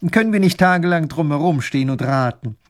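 resolute. A narrator's reading. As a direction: end the guessing with authority. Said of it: "meint entschlossen der Großherzog".

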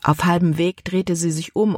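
ordinary delivery

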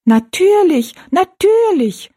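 very high, loud little echo; high-pitched dwarf chorus, drawn out at the end; piping dwarfs. A narrator's reading. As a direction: chirp it high like tiny people. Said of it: "wiederholten die drei Zwergenmenschen".

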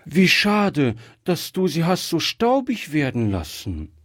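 put-on high-pitched little girl's lament; child calling out. A narrator's reading. Gretchen seeing mama's vase so dusty, high regretful exclamation. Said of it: "rief Gretchen".

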